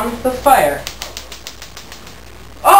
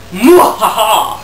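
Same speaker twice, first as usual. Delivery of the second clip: sneering panto villain; 4 dB louder, slower than usual. Acted. Ends the demo with laugh.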